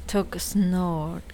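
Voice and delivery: ordinary delivery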